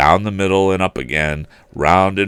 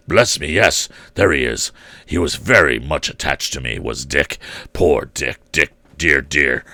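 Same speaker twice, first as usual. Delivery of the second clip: put-on fond old Scrooge; 5 semitones lower than usual. A narrator's reading. Scrooge fondly recalling Dick.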